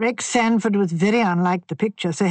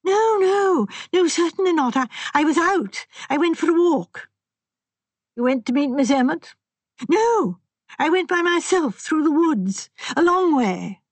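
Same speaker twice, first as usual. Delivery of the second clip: put-on higher, fretful voice of a nervous young man; distressed young man; 8 semitones higher than usual. A narrator's reading. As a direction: voice him anxious and upset. Sanford voiced in story.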